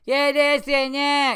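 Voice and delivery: high, loud, drawn-out nonsense yell; mock heckler yelling. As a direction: imitate a heckler yelling over the show. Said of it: "she was just yelling out stuff".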